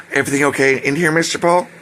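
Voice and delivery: imitated official boss. Official boss checking on her worker in the room, imitated officious voice. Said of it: "she's very official".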